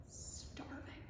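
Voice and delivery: soft whisper; whispered starving. A narrator's reading. Starving king in his bed, whispered. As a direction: breathe it out weakly.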